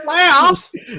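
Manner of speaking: high, loud incredulous squeal; mock disbelief. Mock incredulous echo of playoffs.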